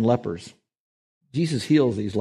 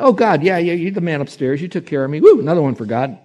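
casual flippant thanks; 6 semitones higher, 7 dB louder than usual. Retold or imitated. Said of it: "You know, and".

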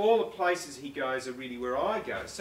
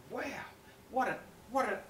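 put-on higher, awed child; kid's wow; 8 semitones higher, faster than usual. Author imagines kids marvelling at history, mock awed child. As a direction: gasp in wonder like a kid. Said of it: "kids might think".

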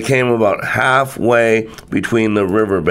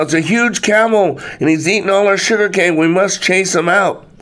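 mock scream; 7 semitones higher than usual. A narrator's reading. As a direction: raise the alarm in a higher voice, mildly acted. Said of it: "screams out".

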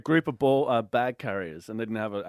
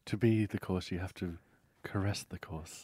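mock seductive; 6 semitones lower, 8 dB quieter than usual. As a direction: purr it like a come-on.

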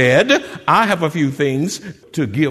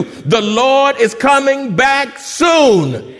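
slow, raised call, words stretched out; loud call; 7 semitones higher, 5 dB louder, much slower than usual. Shown as a demonstration. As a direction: call it out again to stir them up. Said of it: "you participate too".

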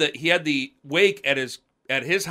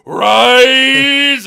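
a huge, high, booming command; commanding shout; 12 semitones higher, 18 dB louder than usual. Retold or imitated. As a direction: bellow it like a preacher raising the dead. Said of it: "And he goes".

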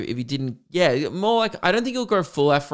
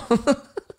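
high giggle; 11 semitones higher than usual. Real, not acted. Recalling the run past his shadow, a high giggle.

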